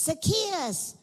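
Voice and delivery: high, projected call of a name. Acted. Jesus to the man up the tree, calling out.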